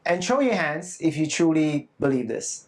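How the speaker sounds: usual speaking voice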